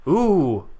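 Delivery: ordinary talk, nothing marked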